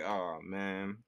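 normal talking voice

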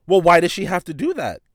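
loud, high-pitched imitation of arguing back; retold indignant challenge. Shopper's friend challenging the clerk, indignant.

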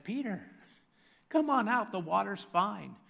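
high, casual, inviting call; Jesus. Acted. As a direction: coax a friend out, light and easy. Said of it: "And Jesus said".

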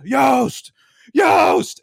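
loud rising shout of a name; hammed-up angry dad yelling; retold yell. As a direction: yell it across the field. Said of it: "he starts yelling at the guy".

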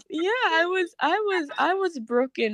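high, tearful, breaking voice; real choked-up crying. Real, not acted. Recalling being broken over her sin, choked up. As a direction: push the words out through tears.

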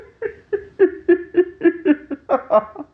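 high laugh. Real, not acted. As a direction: keep laughing. Still laughing.

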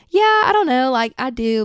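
high, loud put-on Southern accent; hammed-up Georgia accent; country accent. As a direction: gush in a country twang. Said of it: "talking to him in a country accent".

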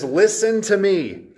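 slow summoning call; wisdom calling out. Acted. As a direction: cry out to the crowd at the gates. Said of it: "She says".

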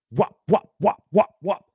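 loud, high rhythmic staple noises; imitated stapler. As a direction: punch out each staple sound.

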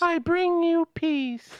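high, slow alien intoning peace; alien visitor voice. Acted. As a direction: speak like a solemn alien envoy. Alien greeting.

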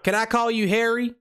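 high-pitched Southern prank caller; Southern accent. Pretend. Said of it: "we're doing the Southern accent".

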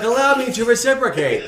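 high, loud hammed-up line; movie character quote. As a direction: imitate a comedy film hero announcing it. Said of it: "He was like".

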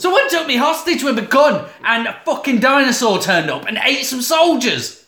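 high, loud retelling in alarm; mock frantic blurting. Kidnapped woman reporting a dinosaur, mock frantic. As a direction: blurt it out in exaggerated disbelief.

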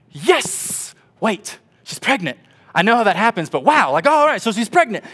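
high, loud, stunned joy; giddy disbelief. Retold or imitated. Young husband hearing his wife is pregnant, giddy and stunned.

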